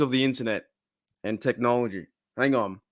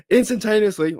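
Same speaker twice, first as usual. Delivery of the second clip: voice cracking; 8 semitones higher, 4 dB louder than usual. Real, not acted. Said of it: "oh my goodness".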